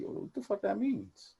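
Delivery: high, squeaky what-the-heck; mock disbelief. To his girlfriend who says he's not the crying type, a high stunned retort. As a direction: play it as stunned offense. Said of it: "I'm like".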